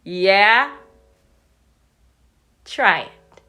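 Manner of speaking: slow, loud, confident goat; mighty goat facing the troll; biggest billy goat. Acted. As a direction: announce it like you fear nothing.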